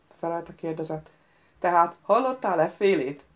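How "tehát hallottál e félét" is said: loud reproachful echo of his words. A narrator's reading. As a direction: quote it back as a reproach. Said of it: "idézte a hang szemrehányóan". (szemrehányóan, hangosan)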